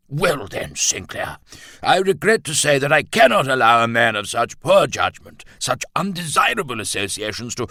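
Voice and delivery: indignant colonel, high and loud; Bennett's voice. A narrator's reading. Colonel forbidding Roland to marry his daughter, loud and pompous. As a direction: pronounce the ban with blustering force.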